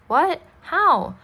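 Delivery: high, loud, disbelieving questions; shocked questions. A narrator's reading. Charlie asks Mom about last week's shooting, shocked. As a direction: pitch leaping up in alarm.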